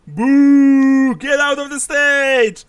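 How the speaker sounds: high, loud heckler's shout, drawn out; booing crowd. Acted. Game master voices the crowd booing a player offstage, loud jeering. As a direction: boo and jeer like a rowdy crowd. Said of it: "while booing you".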